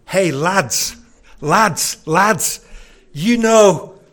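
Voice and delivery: loud, bluff Yorkshire lad calling his mates; rally the lads in broad Yorkshire; Yorkshire accent. Retold or imitated. Herod calling to his boys, loud Yorkshire accent. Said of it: "Herod sounded a little bit like a Yorkshireman".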